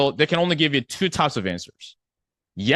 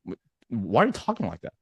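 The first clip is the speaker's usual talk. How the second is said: hesitant, lower and softer baffled reply; freaked out; 5 semitones lower, 5 dB quieter than usual. Acted. Friend reacting to sales talk at the bar, mildly freaked out. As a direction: stammer it softly, as if thrown by a friend. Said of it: "I'll be freaked out".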